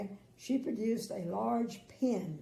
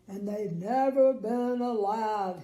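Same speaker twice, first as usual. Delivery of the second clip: slow, louder sob; sobbing king; 8 dB louder than usual. Acted. King sobs about his sad land, slow and louder. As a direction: sob it out slowly. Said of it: "he sobbed".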